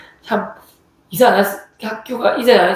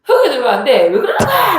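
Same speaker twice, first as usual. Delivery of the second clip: louder, higher imitated father's yell; retold shout of a furious father; 7 semitones higher, 3 dB louder than usual. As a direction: bark it like an angry father. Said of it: "라고 소리를 지셨".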